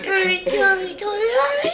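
high sing-song cat calling; high kitty call. Pretend.

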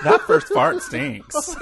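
giggling through the words; laughing. Real, not acted. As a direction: laugh it out, slow and high. Laughing while telling a gross joke.

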